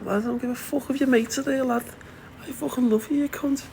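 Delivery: high-pitched, blunt declaration of love to his son; gushing at son. Retold or imitated. Telling his teenage son he loves him, high and gushing. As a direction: belt it out to the lad, no shame in front of his mates. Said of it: "I'd be like".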